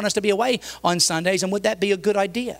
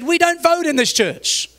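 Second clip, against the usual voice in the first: high, slow, raised voice; retold emphatic rebuttal; firm overruling; 10 semitones higher, 4 dB louder, slower than usual. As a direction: lay down the law, drawing it out. Pastor overrules in retold quarrel.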